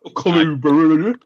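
Colubrid stretched out slowly as kuh-LOOO-brid; exaggerated pronunciation. Shown as a demonstration. Guest hammering home the term, exaggerated slow pronunciation. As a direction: draw out the LOO in Colubrid. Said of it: "learn how to fucking pronounce it".

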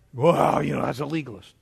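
low, dismissive mimicry; put-on low voice of a doubter; mock objector. Mimics an objector to his view.

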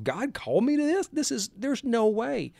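high-pitched, disbelieving inner protest; incredulous disbelief. Retold or imitated. Preacher recalling his first awful reading, incredulous. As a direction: retell a stunned thought, voice leaping up high.

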